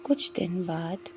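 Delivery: plain reading voice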